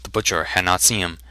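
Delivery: usual speaking voice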